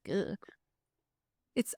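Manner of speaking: usual speaking voice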